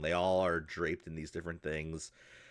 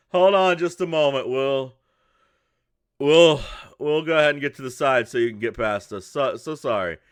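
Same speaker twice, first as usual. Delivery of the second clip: loud, higher, hurried-to-help dad voice; apologetic father; 6 semitones higher, 11 dB louder than usual. Acted. Father offers to move the caravan aside, loud apologetic voice. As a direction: call out an apology to a traveler.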